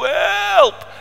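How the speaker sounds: high, drawn cry, held back in volume; a smaller high-pitched yell for help; repeated cry for help. Acted. Storyteller redoing the drowning man's cry, high but restrained. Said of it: "Klonk er een enorme schreeuw".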